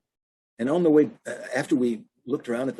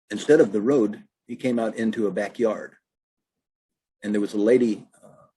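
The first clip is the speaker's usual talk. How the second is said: ordinary talk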